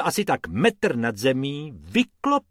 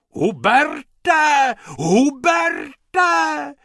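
very high, drawn-out shriek of a name; 6 dB louder, much slower than usual. Acted. Lady running to the pool calling Hubert, shrieking. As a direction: shriek out for someone far away. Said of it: "Ječela".

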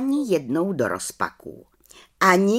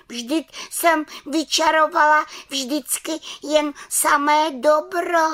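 high hurt protest; 10 semitones higher than usual. Acted. Little witch protests, offended.

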